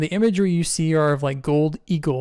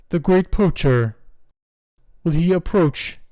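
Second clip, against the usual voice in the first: slow, grave, louder royal question; penguin king; 3 semitones higher, 4 dB louder than usual. Acted. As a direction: ask it weightily like a wary king. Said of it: "he turns and he says".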